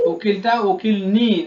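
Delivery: slow উকিল নি with নি pressed and falling; stress on নি. Shown as a demonstration. Teacher showing the feminine ending, stressing নি. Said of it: "নয় সে নি জুড়ে দাও".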